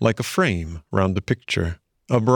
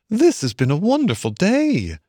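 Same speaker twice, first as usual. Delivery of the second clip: higher, lightly thrilled voice; wide-eyed delight; delighted Mole; 10 semitones higher than usual. A narrator's reading. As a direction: gush a little, pitched up high.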